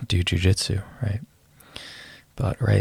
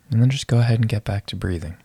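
calm breath-counting guide voice; guided meditation. Acted.

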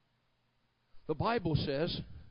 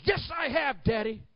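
put-on high, louder child's protest; insistent little boy; 6 semitones higher, 4 dB louder, slower than usual. Little boy standing up to his dad, acted insistence. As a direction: stand up to Daddy in a raised boy's voice. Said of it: "The little boy stands up and says".

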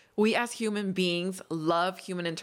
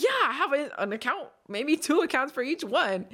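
higher, eager and bright; 8 semitones higher than usual. Retold or imitated. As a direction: answer brightly, pitched up. Retold eager answer.

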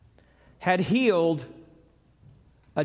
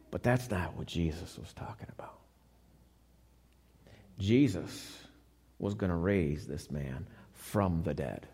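whispered aside, low and soft; hushed whisper; 12 semitones lower, 10 dB quieter than usual. Real, not acted. Preacher hushing the congregation before the miracle, whispered. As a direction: lean in and whisper the secret.

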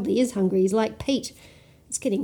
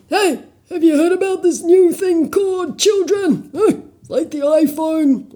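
loud rock voice; 7 semitones higher, 8 dB louder than usual. Acted. Rocks chatting at a party.

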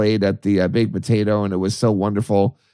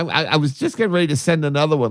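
usual speaking voice

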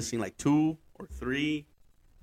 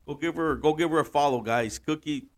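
ordinary talk

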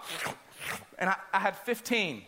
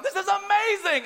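thrilled; 11 semitones higher, 3 dB louder than usual. Retold or imitated. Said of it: "And I'm like".

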